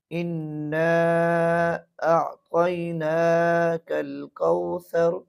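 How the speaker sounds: इन्ना with the nun held in the nose; slow recitation with nasal ghunna on इन्ना. Shown as a demonstration. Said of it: "ये गुना है".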